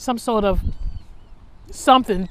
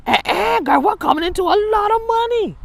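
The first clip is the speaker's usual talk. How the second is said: much higher, loud put-on glee; mock excitement; 12 semitones higher, 4 dB louder than usual. Pretending to her cousin the money is a surprise, high glee. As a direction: ham up the thrill of riches. Acting amazed at found money.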